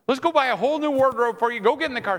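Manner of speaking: high, loud mock enthusiasm; over-eager husband. Husband offering wife new wardrobe, over-eager. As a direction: rush her off with a grand offer. Husband overdoing a wrong fix.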